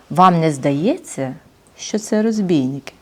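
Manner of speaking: тихо, змовницьки, повільно; прошепочи підозру; пошепки. Acted. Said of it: "пошепки запитав привид". (пошепки запитує привид)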